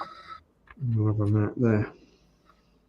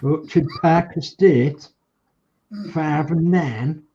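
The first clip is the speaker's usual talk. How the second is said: slow, louder broad Hull accent; 6 semitones higher, 5 dB louder, slower than usual. Shown as a demonstration. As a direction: lay the Hull accent on thick, slowly. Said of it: "my Hull accent going".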